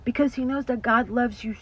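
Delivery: loud, rising preacher's warning; fiery sermon voice; preaching at full force. Real, not acted. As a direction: preach it out to the congregation. Preaching about the enemy.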